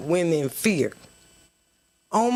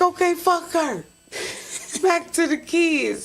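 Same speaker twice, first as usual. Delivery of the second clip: mock dismissive; 11 semitones higher than usual. Dismissing probation officer.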